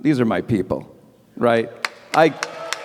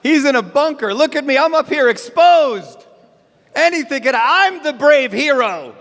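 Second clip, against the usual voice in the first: pompous, puffed-up bragging voice, raised high; mock boastful leader; 6 semitones higher than usual. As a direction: play a cowardly boaster bragging about his bravery. Mocking a politician's boast.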